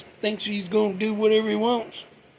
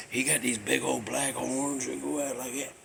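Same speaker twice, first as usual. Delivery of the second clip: ordinary delivery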